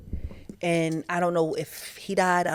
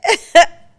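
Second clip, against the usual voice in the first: loud laugh; 11 semitones higher, 15 dB louder than usual. Real, not acted. Laughing at own confession.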